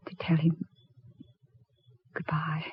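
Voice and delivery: soft, unvoiced farewell; whispered goodbye. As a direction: let the word fade to a whisper. Widow's farewell to her husband.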